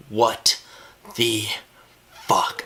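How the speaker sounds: each word set apart by a long pause; retold shock, word by stunned word; stunned disbelief. Teen finds parents at his open laptop, stunned.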